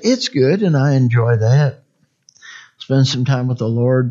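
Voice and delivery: normal talking voice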